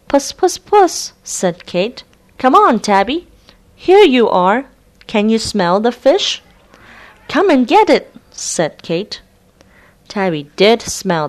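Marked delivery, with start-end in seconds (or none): 0.1-1.1 much higher puss puss call; sing-song call to the cat. Acted. Kate calls the cat. 2.4-8.1 higher, louder tempting call; coaxing a cat. Acted. Kate tempts cat with fish.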